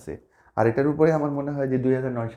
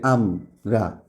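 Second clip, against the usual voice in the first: "আমরা" split slowly as আম, রা; syllable by syllable; 4 semitones lower, much slower than usual. Shown as a demonstration. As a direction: pause between the two syllables of "আমরা". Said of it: "এটা একটা syllable".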